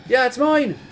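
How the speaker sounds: put-on loud, high Irish-accented reply; eager Irish brogue over bar noise. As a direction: shout back in a practised Irish accent. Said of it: "I would practice my Irish accent".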